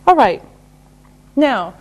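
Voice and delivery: ordinary talk, nothing marked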